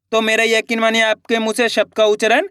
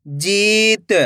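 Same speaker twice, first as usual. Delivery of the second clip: जीत joined as one word with long ई; blended word; much slower than usual. Shown as a demonstration. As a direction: read जीत whole, stretching the जी. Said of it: "खींच के पढ़ना है".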